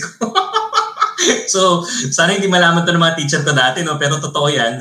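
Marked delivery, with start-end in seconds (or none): 0.1-1.4 high sheepish laugh; real embarrassed chuckle. Real, not acted. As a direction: laugh guiltily, pitched way up. Laughs before confessing school trick.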